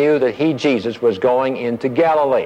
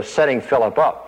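ordinary talk

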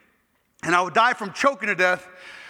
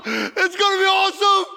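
higher and louder, tearful joyful shout; crying out in joy; 10 semitones higher, 4 dB louder than usual. Retold or imitated. Jesus promising paradise again, joyful shout. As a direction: cry it out, overwhelmed with joy. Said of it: "He starts laughing out loud".

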